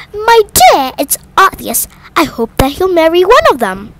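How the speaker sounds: high, eager matchmaking mother, rising to a cry; a scheming mother thrilled about a rich bachelor; gushing mother. A narrator's reading. Mrs Bennet to husband.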